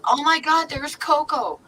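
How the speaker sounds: high, loud shriek of surprise; shocked gasp. Retold or imitated. Friend spots her lost cat, high shocked cry. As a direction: blurt it out, suddenly spotting the cat. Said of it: "and she says".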